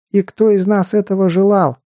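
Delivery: high angry shout; angry outcry. Acted. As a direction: fling the question furiously. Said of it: "гневно выкрикнула Дилария".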